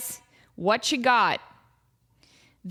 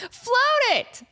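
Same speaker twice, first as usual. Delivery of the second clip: mock high; 3 dB louder than usual. Louder mock repeat.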